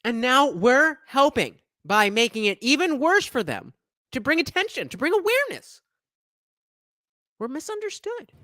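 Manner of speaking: rising into a high, plaintive mock whine; mock activist. Mocks the activist interviewee as victim.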